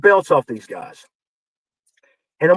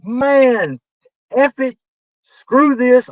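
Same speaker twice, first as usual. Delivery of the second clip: fed up, higher and louder; mock blow-up in a raised voice; retold exasperation; 7 semitones higher, 4 dB louder, much slower than usual. Writer to Randy over the champion, retold exasperation.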